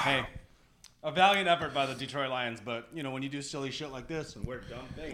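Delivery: muffled through a rubber mask; speaking from inside a mask. Real, not acted. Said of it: "They can't hear you".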